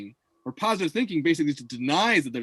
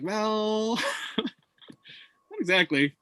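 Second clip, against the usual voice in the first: higher, slow doubtful retort; skeptical; 6 semitones higher, much slower than usual. Retold or imitated. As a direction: drag it out with a laugh of disbelief. Mocking prosperity gospel claim.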